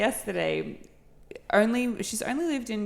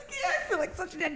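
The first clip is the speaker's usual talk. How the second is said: higher, rushed, laughing self-mockery; embarrassed laughing; 11 semitones higher, 4 dB quieter, much faster than usual. Friend confesses feeling outdone by a coworker, embarrassed laughing. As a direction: laugh through the embarrassment, pitched up.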